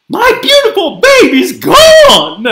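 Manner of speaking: high, loud motherly wail; distraught shout. A narrator's reading. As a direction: cry out loudly for your lost baby. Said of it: "she shouted and sobbed".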